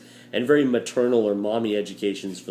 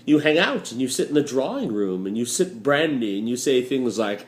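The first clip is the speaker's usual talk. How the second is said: normal talking voice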